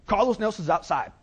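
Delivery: high, loud mock bellow; Carlos Nelson. Client phones at night, high loud announcement. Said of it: "He's like, he's like".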